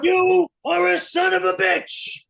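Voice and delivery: high, loud, slow-stretched insult; imitated blow-up; mock furious shout. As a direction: bellow it in hammed-up outrage. Gene explodes at the prank.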